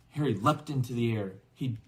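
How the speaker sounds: usual speaking voice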